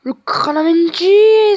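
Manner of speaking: plus fort, haut et lent; cri étiré. Real, not acted. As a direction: allonge le nom de dépit. Arrêt du gardien, cri étiré. (cri de dépit étiré)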